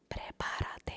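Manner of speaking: menacing whispered warning. Retold or imitated. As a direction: breathe it into her ear like a threat. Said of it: "whisper in my ear".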